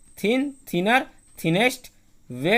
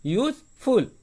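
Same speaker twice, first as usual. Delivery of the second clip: Useful split slowly, a gap before फुल; useful as two separate pieces; pause between syllables; 2 semitones higher, much slower than usual. Shown as a demonstration. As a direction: break useful into यूज, then फुल. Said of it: "तो रुक रहे हैं हम लोग".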